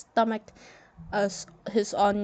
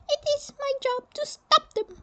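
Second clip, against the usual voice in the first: a much higher, firm general announcing the burial; stern general voice. Acted. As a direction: speak as a proud Egyptian general, high and set. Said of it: "the general glared at him".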